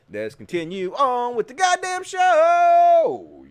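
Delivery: high, slow, drawn-out ringmaster call; hammed-up show host flourish; showman announcer. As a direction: build to a big held final word. Showman's transition.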